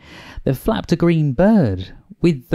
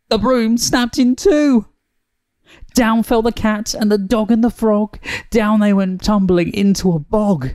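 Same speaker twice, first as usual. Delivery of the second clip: high-pitched alarmed storytelling; dramatic narration; 10 semitones higher than usual. A narrator's reading. Narrator telling of the broom breaking, high and dramatic. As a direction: narrate the disaster high and urgently.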